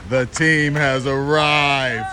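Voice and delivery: slow, stretched-out announcement; triumphant announcement. Real, not acted. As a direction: draw out each word to herald the team. Announcing the team's arrival.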